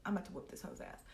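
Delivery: hushed, unvoiced threat under the breath; whispered threat. Telling herself she's going to fight the girl, in a whisper. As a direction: murmur it like a secret vow. Muttering plan to fight her.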